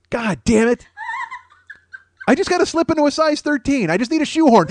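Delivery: ordinary talk, nothing marked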